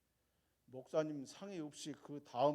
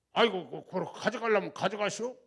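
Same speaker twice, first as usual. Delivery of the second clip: loud, giving-in Jeolla reply; Jeolla dialect; 3 semitones higher, 8 dB louder than usual. Retold or imitated. Landowner giving up the land, loud Jeolla dialect. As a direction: give in with a Jeolla sigh of aigo. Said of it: "전라도 말에 솔찬히 잘합니다".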